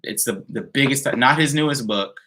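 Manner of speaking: normal talking voice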